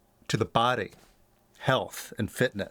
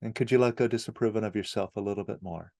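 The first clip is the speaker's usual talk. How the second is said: lower, softer meditation guide with long pauses; guided meditation; 6 semitones lower, 4 dB quieter than usual. Acted. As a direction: gently lead the listener through letting go, leaving long silences.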